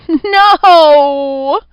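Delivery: high, loud, strained falsetto protest; strained falsetto cry. A narrator's reading. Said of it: "The pitch of the strained cry was exact".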